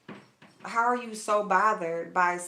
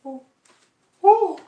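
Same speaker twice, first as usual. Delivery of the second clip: big high yawn, the second one loud; loud yawning Ooh; 11 semitones higher, 13 dB louder than usual. Real, not acted. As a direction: let a real yawn carry the Ooh. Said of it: "y'all, I'm yawning".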